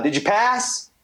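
high called-out question; yelling coach. Retold or imitated. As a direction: coach calling out across the field. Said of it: "the coach yells out".

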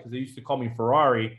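usual speaking voice

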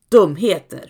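loud, sharp grandmother's scold; hissing scold. A narrator's reading. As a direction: spit the word out at the girl. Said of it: "fräser mormor". (fräsande, hög utskällning)

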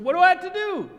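very high, loud hammed-up plea; over-the-top shouted question; eager shout. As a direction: yell it like a student desperate to know. Acted desperate eager question to professor.

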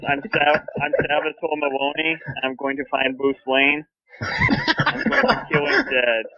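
imitating Maroni, l sounding like w in "Maloney"; says w for r. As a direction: Elmer Fudd style, "Maloney" for Salvatore's name, r turned to w. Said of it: "can't pronounce his R's".

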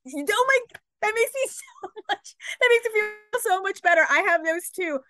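high, laughing delight; excited. Real, not acted. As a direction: squeal with recognition.

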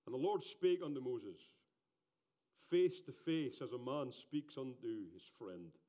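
loud, solemn scripture reading; booming biblical narration. Acted. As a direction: proclaim face to face. Reads scripture aloud.

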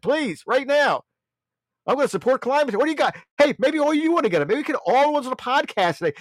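high-pitched, hammed-up urgent sales pitch; mock climate huckster. Host mocking climate campaigners begging for money, high urgent pitch. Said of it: "Maybe I should go up and start talking".

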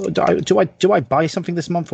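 usual speaking voice

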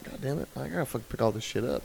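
muttering under breath. Retold or imitated. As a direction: mutter it fast so the landlord won't hear. Grumbling about beer cans on porch.

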